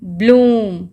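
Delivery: ब्लूम loud, with a long held oo. Shown as a demonstration. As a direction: stretch the vowel of ब्लूम. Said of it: "ब्लूम में भी double ओ लंबा है".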